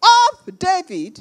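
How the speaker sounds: acted shout to someone far off; shouted cry. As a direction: call out the name slowly and high. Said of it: "He shouted".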